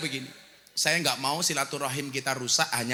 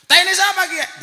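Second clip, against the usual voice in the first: very high, loud comic shout; mock outraged outburst; retold yell; 14 semitones higher, 14 dB louder than usual. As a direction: yell it like the offended visitor in the joke. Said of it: "Langsung dia teriak".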